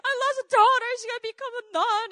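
high, loud, overdone lament; mock wailing. Mom lamenting to her sister on the phone, mock wailing. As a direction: cry out like a devastated mother, hammed up. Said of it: "was also crying on the phone".

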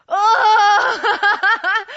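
very loud, high comic groan; hammed-up long wail of pain. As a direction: groan loudly as the cramp hits. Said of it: "It's just me groaning".

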